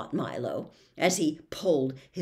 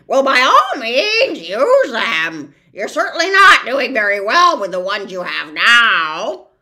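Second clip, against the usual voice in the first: mocking bird; 4 semitones higher, 15 dB louder than usual. The bird mocks Milo's words, loud and high. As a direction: rub it in like a smug bird.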